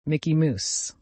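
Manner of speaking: Mickey Moose, Mouse said with a long oo; pre-vowel-shift pronunciation. Shown as a demonstration. Quizmaster answers how English once pronounced Mickey Mouse, old long-oo vowel in Moose. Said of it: "how would the English pronounce Mickey Mouse".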